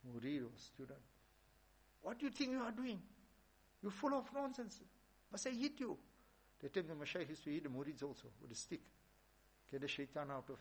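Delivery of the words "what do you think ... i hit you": high-pitched mock rage, scolding hard; angry shout. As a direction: yell at the disciple as a furious master. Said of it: "Junaid Baghdadi is shouting".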